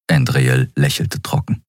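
ordinary delivery, nothing marked

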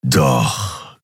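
low growled retort; low, menacing beast growl. A narrator's reading. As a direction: growl it back at him. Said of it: "knurrte Keru".

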